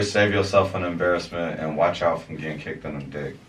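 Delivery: usual speaking voice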